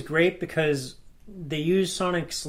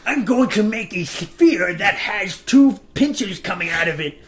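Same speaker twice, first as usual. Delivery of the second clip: high, slow scheming villain; mad scientist voice; 5 semitones higher, 7 dB louder, slower than usual. Retold or imitated. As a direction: plot it out slowly. Mock Eggman scheming.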